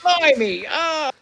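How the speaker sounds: high cartoon Cockney exclamation; squeaky blimey, mock Londoner. Cohost adds a high cartoon British exclamation. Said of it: "the stereotypical British accent".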